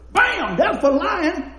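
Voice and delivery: high, loud hammed-up "Bam!" as the teacher; loud retold teacher punishing pupils, laughing; mock teacher smacking. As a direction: swing each "Bam!" like a paddle, mock stern. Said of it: "She goes".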